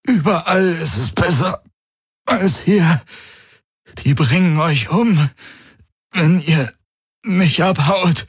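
high, labored, broken phrases; strained croak. A narrator's reading. Garland warning that they will be killed, croaking. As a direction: croak out the warning between breaths. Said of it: "krächzte Garland".

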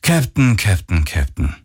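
falling, sighed repetition; wearily chiding, stepping down in pitch; sighing reproach. A narrator's reading. Stranger chiding the captain over comm, sighing. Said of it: "ertönte es seufzend".

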